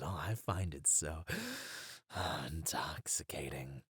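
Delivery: whisper. Acted. Villain savouring hero's defiance.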